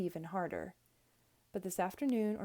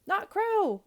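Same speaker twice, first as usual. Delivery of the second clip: high, loud gleeful call; 13 semitones higher, 7 dB louder than usual. A narrator's reading. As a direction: call out to a bird. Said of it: "I called to the tiny bird".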